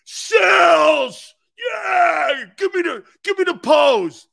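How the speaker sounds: high, loud fan shout calling out the host; South Philly fan yelling. Retold or imitated. South Philly fan shouting at the host, loud and high. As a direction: holler like an excited fan begging for a pose. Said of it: "a guy from South Philly going".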